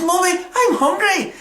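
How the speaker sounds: put-on high-pitched child's voice; little girl. Revived little girl to her mum, high acted child's plea. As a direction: pipe up like a hungry child.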